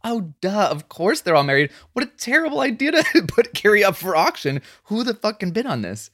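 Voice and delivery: high-pitched, exasperated disbelief; incredulous reaction. Retold or imitated. Recalling his reaction to Carrie's auction, incredulous. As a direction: replay your appalled realization, pitch climbing high.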